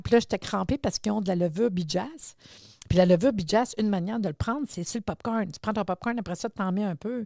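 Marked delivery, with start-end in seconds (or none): none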